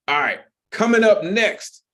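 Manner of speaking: high, loud announcer call; hype announcement. Real, not acted. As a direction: bring up the next act with a lift. Host announcing next act.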